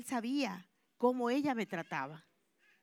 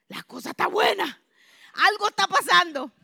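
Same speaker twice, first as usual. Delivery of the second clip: gleeful excitement; 6 semitones higher, 7 dB louder than usual. Retold or imitated. Gleeful aside as boss's trouble unfolds.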